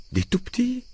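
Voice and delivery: high, soft, shocked question; appalled mother's high-pitched query. A narrator's reading. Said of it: "demanda ma mère choquée".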